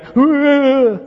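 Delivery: high, loud mock wailing cry; a hammed-up mourning wail; loud, high imitation of a grieving wail; imitated wail. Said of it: "everyone's going".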